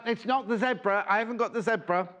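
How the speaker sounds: ordinary talk, nothing marked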